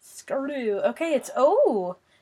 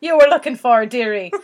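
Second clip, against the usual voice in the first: hammed-up Scottish, leaning Irish; mock Scottish accent; 7 dB louder than usual. Correcting the co-host's stumbled word, mock Scottish accent. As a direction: tease them in a put-on Scottish brogue. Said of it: "read this in a Scottish accent".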